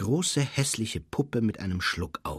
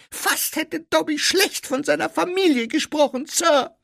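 very high, loud squeaky elf; Dobby; 7 dB louder than usual. A narrator's reading. Elf nearly spoke ill of family, agitated squeak. Elf confesses near disloyalty.

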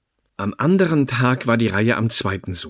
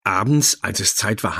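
plain reading voice

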